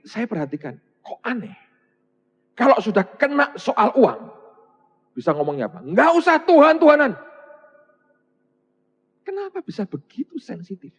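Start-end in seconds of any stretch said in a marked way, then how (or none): 5.8-7.1 scornful retort. Retold or imitated. Mocking a money-focused retort.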